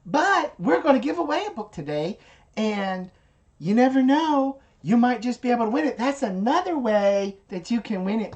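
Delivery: high, lively giveaway pitch as Amy; Amy impression. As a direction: hype the book giveaway like Amy does. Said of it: "So that's my impression of Amy".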